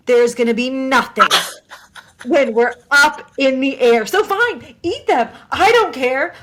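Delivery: loud, emphatic flight attendant scolding; exasperated imitated lecture; retold telling-off. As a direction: scold a cabin full of passengers, leaning on key words. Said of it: "getting yelled at".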